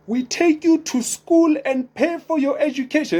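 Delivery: high Nigerian ad announcer voice; Nigerian accent. Retold or imitated. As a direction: sing it out like an old Nigerian advert. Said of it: "would have a Nigerian voice in the end".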